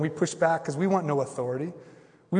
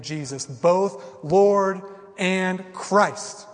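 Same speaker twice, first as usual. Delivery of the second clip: slow, weighty quote building louder; driving it home; 3 semitones higher, 5 dB louder, slower than usual. Retold or imitated. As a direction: hammer Peter's words home slowly. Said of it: "takes that knife and drives it home and says this".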